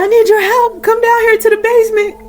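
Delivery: high-pitched retold yell from the basement; is not needed, acted high call; mother's yell. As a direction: imitate a mom calling up for help. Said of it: "her mom was yelling from the basement".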